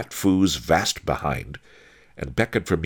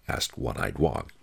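plain reading voice

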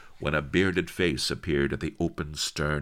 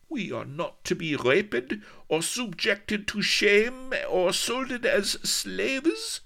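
very high, slowing disbelief; high, slow, accented astonishment; astonished Spanish lady; slower than usual. Acted. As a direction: stretch out the incredulous question.

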